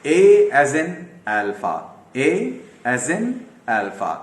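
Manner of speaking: A as in alpha, each word drawn out slowly; teacher slowly modelling "A as in alpha"; slow spelled-out demo. Shown as a demonstration. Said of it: "ये ए है जैसे कि अल्फा में होता है".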